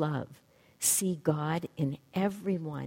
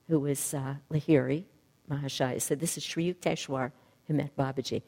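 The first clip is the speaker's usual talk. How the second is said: normal talking voice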